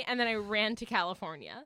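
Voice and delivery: ordinary talk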